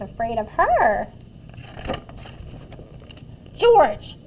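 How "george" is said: loud, raised-pitch mother's reprimand; sharp call from a cross mom; scolding yell. A narrator's reading. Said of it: "she yelled".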